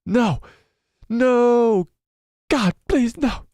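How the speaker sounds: high, drawn-out cries of no; Michael Scott. Acted. Streamer imitating a favourite meme for viewers, high dramatic pleading. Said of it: "Michael Scott doing that is the best".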